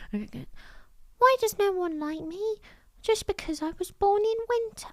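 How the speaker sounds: a tiny sad lamb asking why nobody likes him; cute lamb voice. Retold or imitated. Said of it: "how cute the Winter Lamb's voice was".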